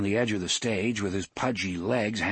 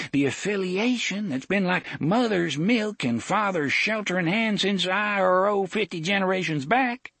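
higher, fussy small-town sheriff; pompous sheriff voice; 8 semitones higher than usual. A narrator's reading. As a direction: play a smarmy country lawman. Sheriff Avery's speech.

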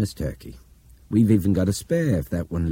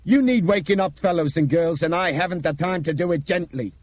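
high, loud, driving speech; intense, vibrating with anger and alarm; angry urgency; 12 semitones higher, 4 dB louder than usual. A narrator's reading. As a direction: rouse everyone with forceful urgency. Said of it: "His voice vibrated with anger and urgency".

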